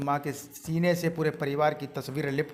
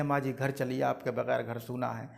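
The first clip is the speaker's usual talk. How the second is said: lower, much softer, humble; tender plea; 4 semitones lower, 7 dB quieter than usual. Acted. Daughter-in-law to her mother-in-law, soft humble plea. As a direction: coax her gently home.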